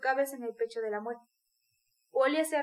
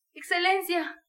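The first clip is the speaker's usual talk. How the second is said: higher, louder cry of Excelencia with a sob; howling sob; 5 semitones higher, 4 dB louder, slower than usual. A narrator's reading. Dying mother wails to the official with a mild sob. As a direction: wail it out as a broken plea. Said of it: "aulló con un sollozo desgarrador".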